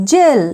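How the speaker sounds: জেল as jail, teacher's model; model word said clearly. Shown as a demonstration. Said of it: "আমরা পড়ব".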